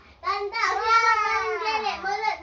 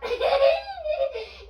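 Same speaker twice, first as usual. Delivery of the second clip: giggling shrieks from tickling; being tickled; 4 semitones higher than usual. Real, not acted. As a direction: squeal with tickled laughter. Anak digelitik. (tertawa geli karena digelitik)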